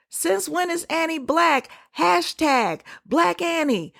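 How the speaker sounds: high-pitched quoted outrage, stretched at the end; mock indignation. Quoting angry Twitter posts about Annie, mock indignation. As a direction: voice an indignant poster, pitched well up.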